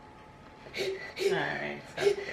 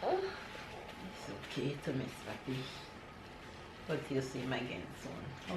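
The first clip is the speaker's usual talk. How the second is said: much softer, slow reassurance; soft comfort; 5 semitones lower, 14 dB quieter than usual. Real, not acted. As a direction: murmur it to him gently. Soft comfort to crying son.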